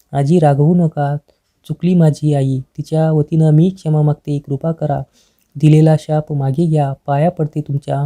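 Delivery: ordinary delivery, nothing marked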